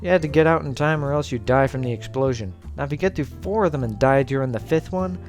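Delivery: ordinary talk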